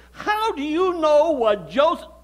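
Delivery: high, loud challenge breaking off abruptly; indignant retort. Acted. Indignant retort to wife, cut short.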